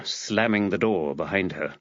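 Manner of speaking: ordinary delivery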